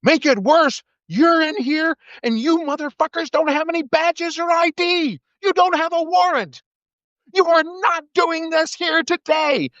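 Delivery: high, shrill-pitched yelling preacher, face to face; retold screaming. Preacher screaming at an ICE agent outside his church, very high yell. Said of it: "nose to nose and screaming".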